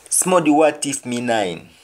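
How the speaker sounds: Jamaican patois line, said twice; patois example for learners. Shown as a demonstration. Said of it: "Somebody wants to steal my pistol".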